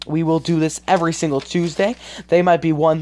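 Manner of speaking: ordinary delivery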